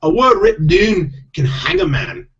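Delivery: high, slow Scottish warning; drawn-out stage-Scottish pixie voice; Scottish accent. Acted. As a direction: warn ominously in a put-on Scottish accent. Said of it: "I do have to do Scottish accents".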